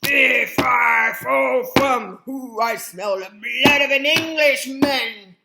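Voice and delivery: a big, slow, booming giant voice. Acted. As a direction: thunder it out like a giant waking the house. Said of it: "That giant came in and".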